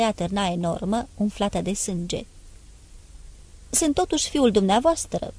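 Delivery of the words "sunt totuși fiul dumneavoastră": higher, a mild moan; moaning plea.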